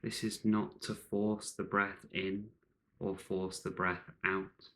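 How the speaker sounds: ordinary talk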